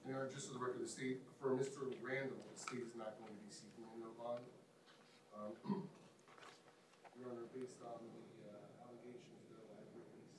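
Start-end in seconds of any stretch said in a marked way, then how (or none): 2.5-8.8 quiet, muffled, as if muted. Real, not acted. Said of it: "I didn't realize I was muted".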